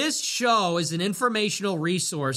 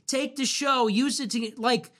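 ordinary talk, nothing marked

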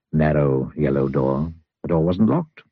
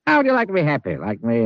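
cheery offer; 7 semitones higher, faster than usual. Acted. Mister Happy offers happiness.

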